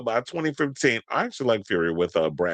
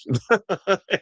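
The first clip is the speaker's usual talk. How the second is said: laugh; 10 semitones higher than usual. Real, not acted. Laughs at own gossip.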